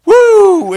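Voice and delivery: loud high whoop; woo. Real, not acted. Streamer whoops at the troll's insult, loud and high. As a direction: let out a big whoop of delight. Reacting to heated exchange.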